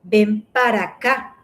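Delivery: "Ven para acá" run together as venparacá; connected "Ven para acá"; linked words. Shown as a demonstration. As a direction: glide "Ven para acá" into one word. Demonstrating how words connect.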